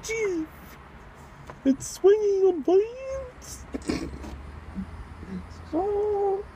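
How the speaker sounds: high, slow, drawn-out silly voice; hushed-up falsetto nature commentary; put-on wildlife spotter. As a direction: point out a creature in mock wonder. Mock sighting of a creature.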